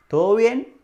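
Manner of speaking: "¿Todo bien?" rising high at the end; questioning tune. Shown as a demonstration. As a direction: ask "¿Todo bien?" loudly, with the pitch climbing. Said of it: "se usa más como pregunta". ("¿Todo bien?" como pregunta, subiendo el tono)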